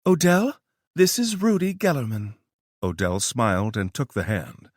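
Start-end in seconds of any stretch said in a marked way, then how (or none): 0.0-2.3 Tara's voice. Acted. Tara introduces the professor.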